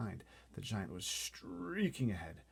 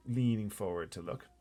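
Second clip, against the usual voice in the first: plain reading voice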